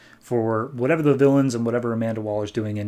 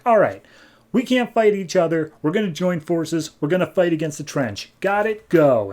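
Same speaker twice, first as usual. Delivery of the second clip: higher-pitched commander's orders; mock rallying order; 5 semitones higher than usual. Aquaman unites both sides against the Trench, mock rallying order.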